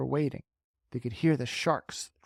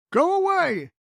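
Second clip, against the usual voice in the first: high, loud shout; 8 dB louder than usual. Acted. Crew shouting at the shark, loud high yell. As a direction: drive the shark off, yelling. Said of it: "they shouted".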